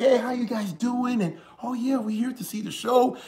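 high, breezy imitation of cheerful small talk; lightly voiced easygoing chat; friendly greeting. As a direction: mimic the brothers being chatty and harmless. Brothers greeting officers friendly.

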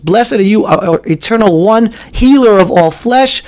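usual speaking voice

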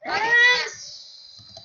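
high-pitched shout of yes; excited shout. Real, not acted. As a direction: cry out in triumph, voice leaping up. Sudden cheer during the game.

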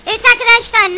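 upbeat streamer hello; host greeting. Real, not acted. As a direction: pump up the viewers. Greeting vlog viewers.

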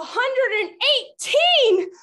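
big reveal. Pretend. Reveals the guessing game answer.

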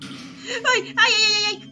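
high, loud frightened wail; startled cry. Real, not acted. Startled by game scare. (grito asustado)

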